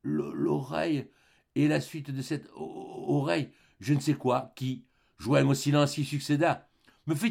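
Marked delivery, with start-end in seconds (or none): 0.0-1.1 slow, halting stammer; faltering, drawn-out stutter; stammering, broken syllables. A narrator's reading. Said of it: "balbutier à syllabe interrompue". 2.5-3.5 soft, faltering stutter; halting, interrupted murmur; stammering, broken syllables. A narrator's reading. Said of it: "balbutier à syllabe interrompue".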